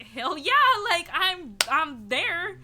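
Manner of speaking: loud, high eager yell; excited yes. Retold or imitated. Friend invites her to a party, loud eager yes. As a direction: jump at the invite.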